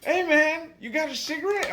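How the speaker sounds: high, insistent mock begging; pestering drunk guy. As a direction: imitate a guy nagging for a smoke. Same request repeated, pestering.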